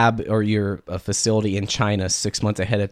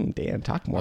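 soft, voiceless musing as a listener; imagined listener's murmur; 5 dB quieter than usual. Retold or imitated.

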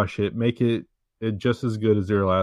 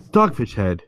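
bright pitchman plug, louder; chipper 1950s ad; 5 dB louder than usual. Pretend. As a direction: sell it like an old radio ad. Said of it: "that's my ad".

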